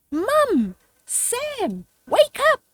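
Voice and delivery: high, loud little mouse squeak; excited squeak. Acted. Young mouse waking family on Christmas, excited squeak. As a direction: squeak it out, bursting with news. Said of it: "she squeaked excitedly".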